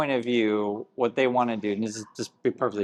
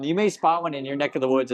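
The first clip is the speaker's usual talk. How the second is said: normal talking voice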